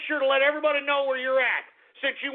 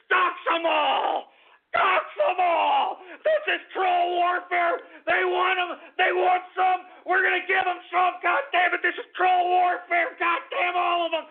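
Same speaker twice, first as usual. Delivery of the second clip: rally the trolls, roaring; furious battle cry; 8 semitones higher than usual. Real, not acted.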